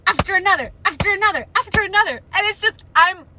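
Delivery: high loud frustrated wail; anguished rant. Real, not acted. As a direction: complain near tears.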